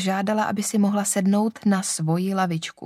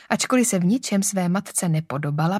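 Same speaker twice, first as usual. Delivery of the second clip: ordinary delivery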